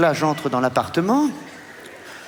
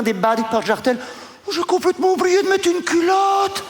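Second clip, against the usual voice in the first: much higher, gushing society lady; posh lady voice; 6 semitones higher than usual. Acted. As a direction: squeal it like an excited rich woman. Barbara seducing him.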